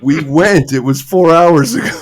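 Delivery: loud, high exasperated reply; exasperated friend. Retold or imitated. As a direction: shout it like it's obvious. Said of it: "And they go".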